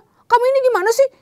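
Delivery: put-on a child snapping back when scolded; mock indignant outburst; angry comeback. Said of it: "balik marah".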